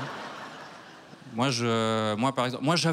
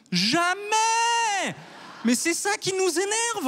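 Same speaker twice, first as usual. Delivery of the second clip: mock indignant shout; much slower than usual. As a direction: shout it like a wounded small neighbour.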